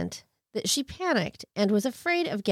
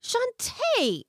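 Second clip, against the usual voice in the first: exasperated; 14 semitones higher than usual. Real, not acted. Exasperated at the killer's choices.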